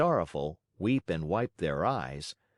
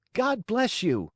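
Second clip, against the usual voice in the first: put-on much higher, a small wooden boy's voice; little Pinocchio; 13 semitones higher, 4 dB louder than usual. A narrator's reading. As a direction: chirp it like a polite puppet.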